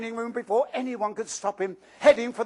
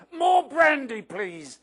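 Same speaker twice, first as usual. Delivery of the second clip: much higher, louder blurt on more brandy; sudden yell; 6 semitones higher, 4 dB louder, slower than usual. Acted. Uncle Vernon cutting Marge off, sudden loud yell. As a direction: shout to drown out the argument. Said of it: "yelled Uncle Vernon".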